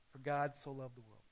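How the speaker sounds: normal talking voice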